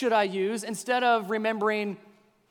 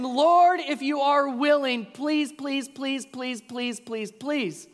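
begging leper; 5 semitones higher, 3 dB louder, much slower than usual. Acted. Leper face down begging Jesus, high then fading pleas. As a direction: beg desperately, then run out of strength. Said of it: "fell with his face to the ground and begged him".

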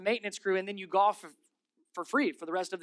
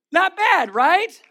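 excited boast; 11 semitones higher, 13 dB louder, much slower than usual. Real, not acted. Proud after juggling trick.

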